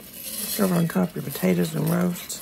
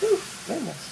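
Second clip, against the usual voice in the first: high, sharp cry of a burn; startled by heat; 8 semitones higher, 4 dB quieter than usual. Real, not acted. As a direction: let it jump up high, caught off guard by the heat. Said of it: "That's hot".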